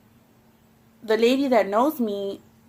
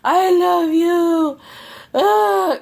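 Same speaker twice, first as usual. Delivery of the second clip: drawn-out, much higher and louder yell; drunk yelling; 9 semitones higher, 6 dB louder, much slower than usual. Retold or imitated. Drunk ex yelling across the apartments, slow high hollered plea. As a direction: bellow it slowly like a drunk ex across the complex. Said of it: "I hear him yelling".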